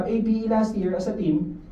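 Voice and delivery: ordinary delivery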